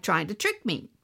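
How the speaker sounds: ordinary delivery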